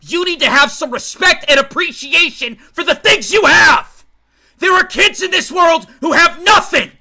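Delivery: furious high shout at his daughter; father screaming at his eleven-year-old daughter; screaming in rage. Acted. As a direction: scream it down at her, losing all control. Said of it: "he starts screaming".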